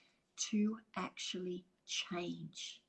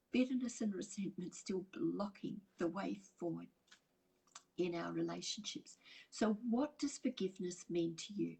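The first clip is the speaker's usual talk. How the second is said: ordinary talk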